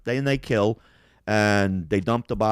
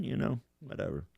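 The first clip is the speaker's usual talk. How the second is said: ordinary talk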